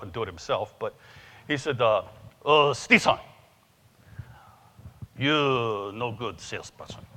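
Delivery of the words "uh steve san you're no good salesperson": lightly voiced Japanese accent, slow on the second line; a hint of Japanese-accented English, unhurried; Yasu's Japanese accent. Speaker imitating his Japanese friend's blunt verdict, light Japanese accent. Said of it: "So I'm gonna do Yasu's voice".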